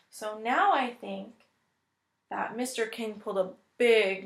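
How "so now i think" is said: ordinary talk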